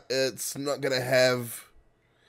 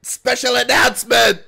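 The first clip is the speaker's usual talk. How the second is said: announcer shout; 9 semitones higher, 8 dB louder than usual. Pretend. Repeats call, far voice.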